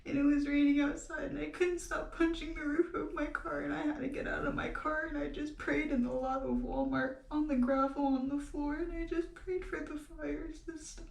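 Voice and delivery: high, upset voice on the edge of crying; tearful. Real, not acted. Telling viewers how she prayed for the fires, tearful. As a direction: push the story out through tears. Tearfully recalling praying over bushfires.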